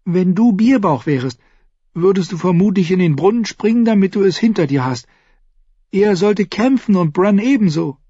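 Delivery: put-on a fierce young girl urging a fight; girl Mira. A narrator's reading. Mira argues, voiced high.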